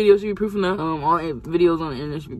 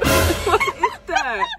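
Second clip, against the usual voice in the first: very high, slow, laughing surprise; surprised; much slower than usual. Real, not acted. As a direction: squeal it out in disbelief. Sees wet hands.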